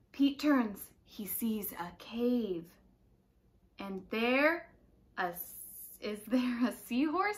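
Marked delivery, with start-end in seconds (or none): 1.0-2.7 slow hushed whisper. A narrator's reading. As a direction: whisper it like a secret. Spooky cave discovery.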